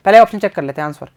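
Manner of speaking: usual speaking voice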